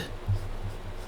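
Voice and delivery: quick rough throat clear. Real, not acted. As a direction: clear the throat mid-sentence. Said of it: "losing my voice".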